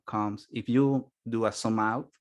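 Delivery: usual speaking voice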